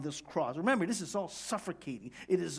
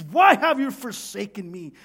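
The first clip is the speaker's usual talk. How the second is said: loud, raised anguished plea; cry of abandonment; 4 semitones higher, 11 dB louder than usual. Acted. Preacher acts Jesus abandoned on the cross, loud cry. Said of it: "A cry of abandonment".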